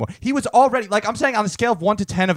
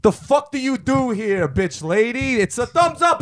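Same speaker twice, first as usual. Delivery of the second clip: higher, slow mock-aggressive taunting; hammed-up rudeness; 3 semitones higher, slower than usual. Pretending to taunt the shop lady, hammed-up aggressive taunting. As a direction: drag it out like a jerk picking a fight. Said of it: "I didn't say it like that, but I was like".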